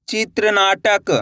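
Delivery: चित्रनाटक drawn out slowly as one word; slow blended reading. Shown as a demonstration. Teacher joining the letters for learners, slow and stretched. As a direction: stretch चित्रनाटक so each part is clear.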